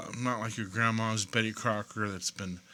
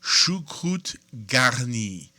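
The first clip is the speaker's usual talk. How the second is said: slow, louder French dish name; French pronunciation; 5 dB louder, slower than usual. Shown as a demonstration. Reviewer giving the dish's French name in a French accent. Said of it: "this dish in French is called".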